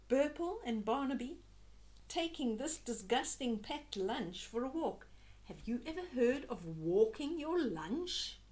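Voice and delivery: plain reading voice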